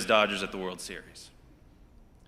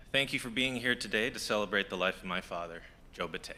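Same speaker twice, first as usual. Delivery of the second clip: soft, choked-up words for his late father; faltering tender eulogy; 8 dB quieter than usual. Real, not acted. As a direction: hold back tears as you speak. Son opening his father's eulogy.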